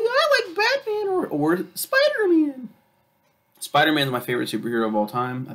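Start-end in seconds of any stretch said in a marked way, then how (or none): 0.0-2.7 put-on much higher little kid voice; squeaky kid, slowing at the end; high child voice. Mimicking a child answering.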